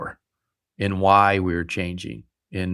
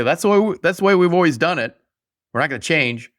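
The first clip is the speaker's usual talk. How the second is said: higher, louder, faster mocking quote; mocking stubborn voice; 7 semitones higher, 4 dB louder, faster than usual. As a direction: sneer it out like a stuck-in-the-mud boss. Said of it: "For someone to say".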